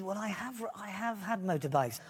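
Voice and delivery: ordinary delivery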